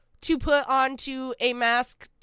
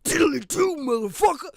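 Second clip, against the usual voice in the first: a gruff hint of Scottish, a bit louder; lightly playing a tough Scot; mock Scottish; 3 dB louder than usual. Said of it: "a Scottish over there like".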